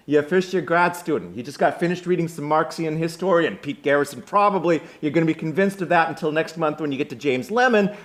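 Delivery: loud, high, fast rant in Brooklyn-tinged Boston; bad Boston accent. Will takes apart the grad student's reading, scornful Brooklyn-sounding Boston accent. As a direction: rattle it off in a botched Boston accent. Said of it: "Again, Brooklyn, not Boston. I'm sorry, we're gonna have to live with it today".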